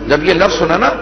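measured, mournful majlis recitation; masaib recitation. Acted. Reciter telling the Kufa story to mourners, mournful. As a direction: chant the tragedy to the mourners.

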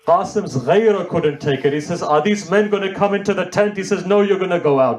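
lament recitation. Acted. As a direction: build the pain of the scene.

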